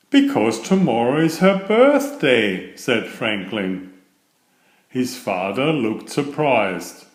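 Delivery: gentle storybook read-aloud; storyteller voice for a children's picture book. A narrator's reading. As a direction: read it like a bedtime story to little ones.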